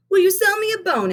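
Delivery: put-on high, eager little boy voice; young Benny asking. A narrator's reading. Benny asks Miss Hill for a rose, high and eager. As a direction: ask sweetly like a small boy. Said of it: "asked Benny".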